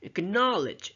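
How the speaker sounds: "acknowledge" with KNOW lifted high; "acknowledge", punching its second syllable; stress on second syllable. Shown as a demonstration. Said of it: "on the second syllable".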